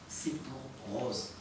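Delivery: slow, soft eerie echo of the recorded voice; whisper-like mock of the voice on the recording; imitating the spirit voice. Telling listeners what the dead dog's owner hears, slow hushed mimic. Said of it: "une personne qui va dire".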